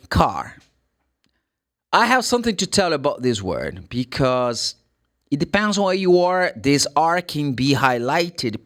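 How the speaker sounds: usual speaking voice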